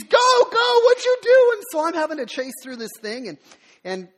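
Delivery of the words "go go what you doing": high, loud frantic urging; wife yelling at husband to hurry. Retold or imitated. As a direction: shout it, panicked and pushing. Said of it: "and she's".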